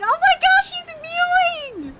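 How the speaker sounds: high loud fangirl squeal; oh my gosh, shrieked with delight; gushing squeal. Real, not acted. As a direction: squeal over a handsome man. Gushing over handsome character.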